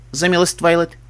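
ordinary delivery

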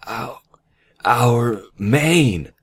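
hushed stammer rising to loud, high shock; stunned, high-pitched exclamation; shocked gasp of words. A narrator's reading. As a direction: start small, then exclaim in disbelief. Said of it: "she gaped, eyes widening in shock".

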